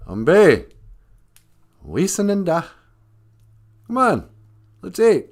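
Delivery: high-pitched grandmother calling the family to eat. Retold or imitated. Grandmother to her little grandson at mealtime, high calling voice. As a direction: call out high, as a grandmother would. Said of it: "I could hear my grandmother say".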